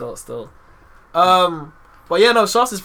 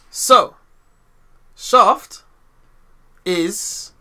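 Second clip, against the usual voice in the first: dramatic announcement; 4 semitones higher, 6 dB louder, much slower than usual. Real, not acted. Dramatic build-up to the name.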